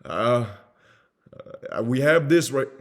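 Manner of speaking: loud, high, halting pitch; mock pushy sales opener; bad salesperson. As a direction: blurt an over-eager greeting, then stall. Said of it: "trying to sell something to someone".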